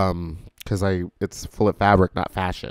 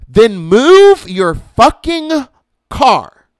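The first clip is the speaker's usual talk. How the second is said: slow, furious yell, much higher and louder; angry yell; 12 semitones higher, 13 dB louder, much slower than usual. Real, not acted. Ranting at drivers blocking the gas pump, furious yell. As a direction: bark it at the driver hogging the pump.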